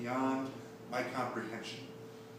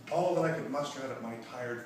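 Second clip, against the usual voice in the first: ordinary talk, nothing marked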